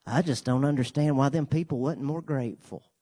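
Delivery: lightly voiced smug congregant; a hint of a clueless self-righteous member; mock snooty churchgoer. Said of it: "they say something like this".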